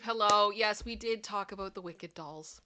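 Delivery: ordinary talk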